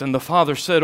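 high, loud impassioned preaching; preaching at full force. Acted. Preacher voicing the father's plea loudly to the congregation. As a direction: plead it out from the pulpit.

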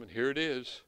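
ordinary delivery

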